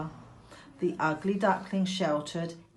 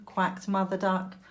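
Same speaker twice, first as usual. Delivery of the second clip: ordinary delivery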